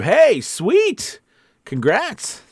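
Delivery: high, delighted cheer; real, upbeat burst of congratulations; excited congratulations. Real, not acted. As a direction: celebrate their good news out loud. Cheering a viewer's good news.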